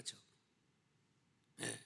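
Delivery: ordinary talk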